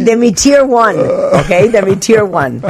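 high-pitched playful threat; mock indignation. Jokingly voicing the expulsion threat.

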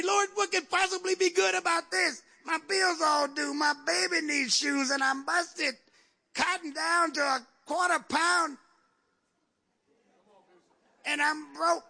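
high, slow, drawn-out lament; mock complaint to God. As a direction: play a broke believer protesting to the Lord. Acts out believer complaining to God.

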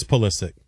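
Pulisic as "PULL-i-sik"; "Pulisic" ending in a hard "sick"; short first syllable. Shown as a demonstration. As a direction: start Pulisic like the word pull, no y-glide. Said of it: "I've always heard it as".

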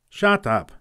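short, high snapped retort; gruff farmer. Acted. Injured farmer silencing the boy with a sharp snap.

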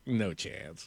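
low, hushed brush-off; dismissive look voiced. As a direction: imitate a star's silent refusal. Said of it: "he just looked at me like".

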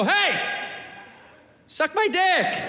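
high-pitched, sweetly friendly offer; nice. Shown as a demonstration. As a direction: say it nicely, lifted and inviting. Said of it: "We should say it nice".

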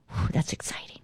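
soft unvoiced whispered aside; hushed awed whisper. Real, not acted. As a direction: breathe it in awe. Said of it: "aside after reading".

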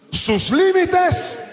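high, loud, drawn-out call; deep-breath shout. Shown as a demonstration. Preacher leading the church in reading the last phrase, shouted. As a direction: fill your lungs and belt it slowly. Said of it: "respire profundo y léame la última frase".

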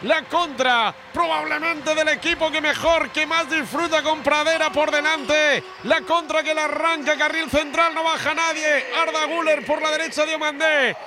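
chanting, full-voiced radio commentary; triumphant Spanish football call; goal recap commentary. Real, not acted. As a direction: declaim each phrase on a high held note, then drop.

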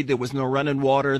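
ordinary talk

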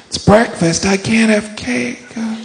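high put-on voice; high hesitant reasoning turning to chirped decision; self-deceiving cake eater. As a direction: play someone fooling themselves, high-pitched. Cake eater kidding themselves at breakfast.

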